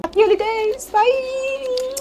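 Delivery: very high playful farewell; squeaky bye. Pretend. Vlogger waving viewers off, squeaky. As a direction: chirp goodbye in a tiny voice.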